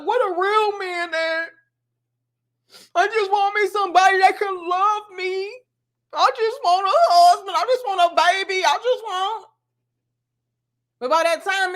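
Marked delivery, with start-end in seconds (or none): 0.0-9.5 higher, pleading mock wail; mock desperate woman. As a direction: beg like a desperate single woman. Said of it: "it's like".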